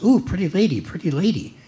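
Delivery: a lightly voiced squawking parrot. Joke parrot greeting the lady, light parrot voice. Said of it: "Parrot goes".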